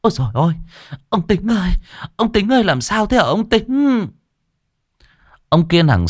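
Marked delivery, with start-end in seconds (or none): 0.0-4.1 high, frantic wife's cry; wife finding husband collapsed, high frantic wail; panicked wailing. Acted. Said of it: "Bà cuống cuồng".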